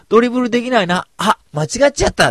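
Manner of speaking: loud mock teasing. Teasing Tifa by grabbing her head, loud and high mock innocence. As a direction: ham up mistaking her head for a ball. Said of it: "ティファちゃんの頭をこうギュッと握る".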